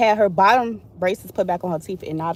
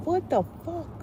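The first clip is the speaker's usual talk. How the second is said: high, softer incredulous gasp; mock disbelief, pitched way up; what the fuck; 9 semitones higher, 8 dB quieter than usual. Telling her orthodontist gap story, imitating her own disbelief. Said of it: "and I'm like".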